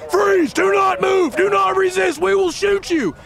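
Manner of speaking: high-pitched barked police commands; urgent, high shouted warning; screaming cop. Acted. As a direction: scream orders at a suspect. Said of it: "one of the cops screams".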